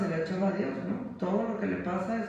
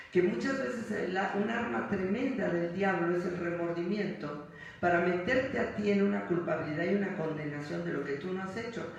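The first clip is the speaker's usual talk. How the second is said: usual speaking voice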